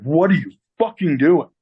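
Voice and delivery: angry boss. Retold or imitated. Boss catches apprentice grabbing the phone, angry demand. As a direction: imitate an incredulous, angry demand.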